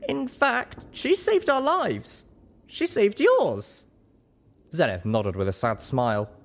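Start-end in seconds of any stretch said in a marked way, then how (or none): 0.1-3.9 high, slow, emphatic protest; pleading pony heroine, every word pressed; insistent. A narrator's reading. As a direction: defend her earnestly in a high voice. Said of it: "I insisted".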